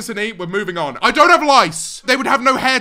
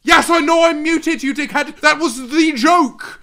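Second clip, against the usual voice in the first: mock annoyed streamer; 6 semitones higher, 7 dB louder than usual. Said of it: "it just being like".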